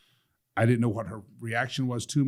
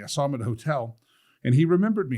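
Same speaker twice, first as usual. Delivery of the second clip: normal talking voice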